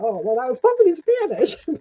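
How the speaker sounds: laughing through an embarrassed inner thought. Retold or imitated. Missionary laughs at her own bad Spanish, laughing. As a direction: chuckle it out, embarrassed at yourself.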